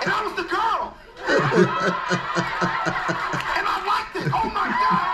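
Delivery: high, laughing confession; comic laughing at his own story. Real, not acted. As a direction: crack up on the punchline.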